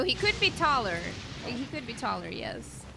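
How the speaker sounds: normal talking voice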